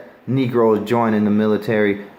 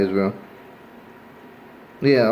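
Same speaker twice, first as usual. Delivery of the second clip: ordinary talk, nothing marked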